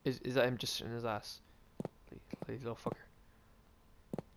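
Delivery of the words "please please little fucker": breathy pleading whisper. Real, not acted. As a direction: whisper it under your breath. Whispering at game.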